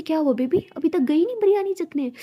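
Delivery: high teasing taunt; mock-sweet taunt. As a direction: needle her sweetly. Teasing partner back.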